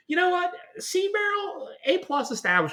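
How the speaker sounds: squeaky high praise; high-pitched exclaiming. Real, not acted. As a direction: pitch way up, gushing.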